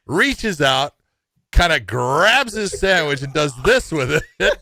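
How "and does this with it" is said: ordinary talk, nothing marked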